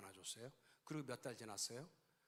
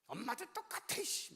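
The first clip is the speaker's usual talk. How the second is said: put-on slow, high childish pout; sulky grumble; 10 semitones higher, 5 dB louder, slower than usual. Son sulking at his mother, slow high childish grumble. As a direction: drag it out like a sulking kid.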